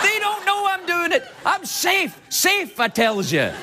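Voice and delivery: high-pitched cackling triumph, hammed up; mock gloating. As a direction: crow like a cartoon schemer who got away. Gloats that he is safe.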